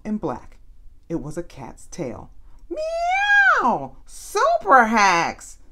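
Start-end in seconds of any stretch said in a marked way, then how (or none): 2.7-3.9 high squeaky meow like a cat. A narrator's reading. Rosamond meows at her found cat, high cat meow. 4.1-5.6 loud, drawn-out happy cry; cried out. A narrator's reading. Rosamond calls to her lost cat, loud joyful cry. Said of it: "Rosamond cried".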